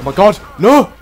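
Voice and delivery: high, loud despairing no; loud panicked protest; panicked cry. Real, not acted. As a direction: cry out in alarm. Panic as chase fails.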